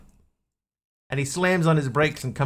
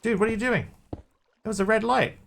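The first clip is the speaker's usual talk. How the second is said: alarmed passenger; 9 semitones higher than usual. Acted. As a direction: pitch it up like a startled passenger. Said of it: "he goes".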